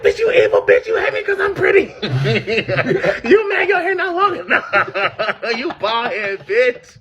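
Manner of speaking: high, loud yelling through laughter; laughing rant. Retold or imitated. Daughter with syrup in her hair yelling insults at mother, high and laughing. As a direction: scream insults while cracking up. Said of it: "I was like".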